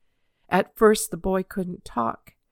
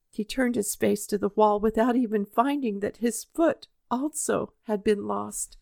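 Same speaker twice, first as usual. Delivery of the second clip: plain reading voice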